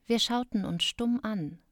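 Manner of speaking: usual speaking voice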